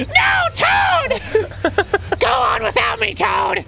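high shouted melodrama; overacted death scene. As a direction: ham up the dying farewell. Dramatic Toad death scene.

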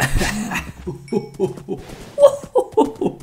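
high, airy laughter; breathy giggle. Real, not acted. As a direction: giggle helplessly at the fun. Laughing after hitting cows.

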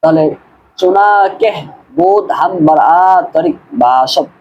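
ordinary talk

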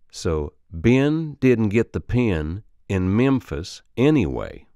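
Alabama accent drill. Shown as a demonstration. Coach modelling the eh change, slow Alabama accent. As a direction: stretch Ben and pen the Alabama way. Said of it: "The eh sound turns to I".

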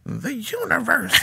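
high, slow, drawn-out narrator voice; Whoopi Goldberg. Retold or imitated. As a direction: stretch it out high and awestruck. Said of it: "it's like Whoopi Goldberg".

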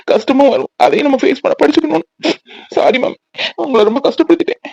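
weepy, choked-up confession; tearful son. Acted. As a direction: apologise to mother through sobs. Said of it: "உன்னை பார்த்தா அவனும் அழுறானா?".

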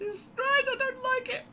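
very high-pitched mock complaint; put-on squeaky voice. Gamer repeats his complaint to himself, silly high squeak. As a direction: squeak it out in falsetto.

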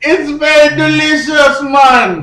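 loud, high, put-on Jamaican accent. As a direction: push it like a hollering comic Jamaican. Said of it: "He's from Jamaica".

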